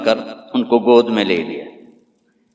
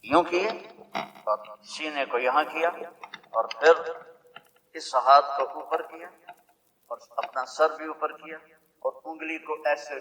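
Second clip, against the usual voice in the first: much softer, almost breathed, in wonder; hushed awe; 3 semitones higher, 10 dB quieter than usual. Acted. Preacher to the congregation, describing the newborn's prostration, hushed. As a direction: lean in and hush the room as if the baby might hear.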